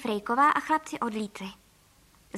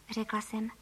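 usual speaking voice

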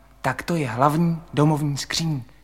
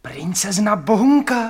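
loud, high surprised question; hlasité zvolání; 5 semitones higher, 5 dB louder than usual. Acted. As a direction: blurt it out far too loudly. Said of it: "Nekřičte, to nesmí nikdo slyšet". (překvapeně vykřiknout)

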